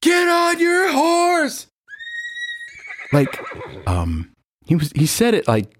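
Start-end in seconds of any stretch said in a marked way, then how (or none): 0.0-1.7 slow, high shouted jeer; drawn-out mocking yell; sarcastic shortstop shouting; yelled taunt. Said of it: "He yells".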